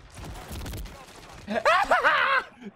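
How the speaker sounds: relieved laugh. Real, not acted. Laughing after the win.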